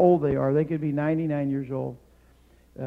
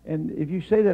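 ordinary talk

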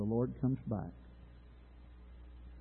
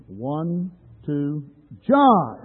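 raised count, then call out the name; loud practice call; 4 semitones higher, 14 dB louder, much slower than usual. Shown as a demonstration. Preacher leading a practice round, loud name call. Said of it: "practice run so we're not so loud".

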